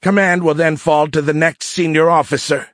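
high, self-important gloat. A narrator's reading. Cadet leader claims command for himself, gloating. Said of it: "he gloated".

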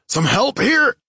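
high, loud call for help; shouted plea. A narrator's reading. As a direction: shout urgently for backup. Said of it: "I shouted".

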